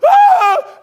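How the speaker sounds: high shrill shriek of alarm; a sudden yelp of panic; panicked scream. Retold or imitated. Boss screaming at the outage.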